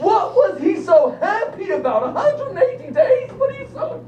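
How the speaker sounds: mock the king's party glee; teacher mocking the king's happiness with laughter.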